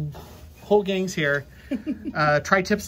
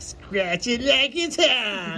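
slow drawn-out friend impression; imitating Lucy; 4 semitones higher, much slower than usual. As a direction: stretch it out slowly like Lucy. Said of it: "Lucy is always like".

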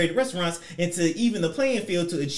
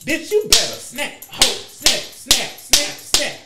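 high, sassy chant of snap; mock diva snap chant; 6 semitones higher, 4 dB louder, slower than usual. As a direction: taunt her with a finger-snapping chant.

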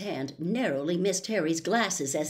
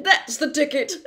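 louder, hearty showman's voice; a vain celebrity professor, loud and chummy; booming, self-satisfied charm; Lockhart voice; 8 semitones higher, 9 dB louder than usual. Acted.

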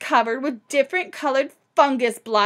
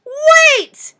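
much higher, loud cry; cried out; 14 dB louder than usual. Acted. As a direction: call after the old woman. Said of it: "she cried".

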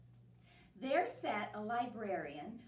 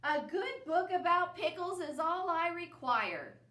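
louder; 8 semitones higher, 7 dB louder than usual. Acted. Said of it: "Bear's voice was getting louder".

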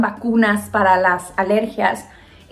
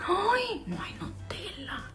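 whispered dismay; 8 semitones higher, 15 dB quieter, slower than usual. Retold or imitated. Mother finds fridge empty.

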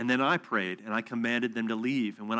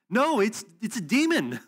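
much higher, louder exclaimed correction; emphatic insistence; 10 semitones higher, 5 dB louder than usual. Retold or imitated. Telling the woman her idols are a demon, high loud exclaim. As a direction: shout it out as the obvious truth.

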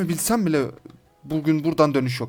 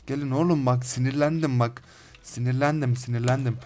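normal talking voice

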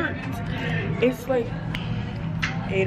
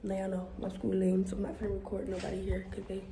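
hushed voice; 10 dB quieter than usual. Real, not acted. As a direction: keep it down, others nearby. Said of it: "In the bathroom".

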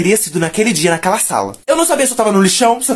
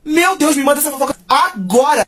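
high-pitched, mock-astonished eagerness; thrilled demand; 6 semitones higher than usual. Creator demands a little fan's gossip, thrilled. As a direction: beg for gossip in a higher, excited voice.

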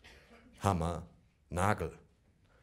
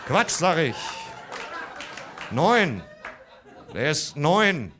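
loud, higher hasty correction; father correcting his son in panic; flustered correction; 8 semitones higher, 6 dB louder, slower than usual. Retold or imitated. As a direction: cut in fast and loud to cover.

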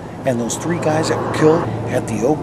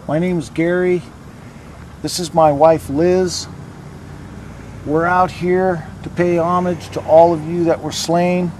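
talking to a ghost; 5 semitones higher, 11 dB louder than usual. Acted. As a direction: call out into the dark graveyard. Calls out to spirits.

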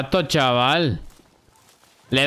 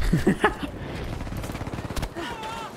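high squeaky laugh; 8 dB quieter than usual. Real, not acted. Reacting to game chaos.

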